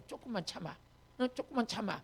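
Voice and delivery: retold pastor's hushed counsel; gentle coaxing. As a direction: quietly urge patience, as if aside. Pastor soothes a struggling member.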